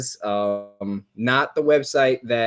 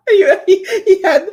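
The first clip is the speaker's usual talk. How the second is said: laughing while quoting; 8 semitones higher, 5 dB louder than usual. Retold or imitated. Laughing retelling a show clip.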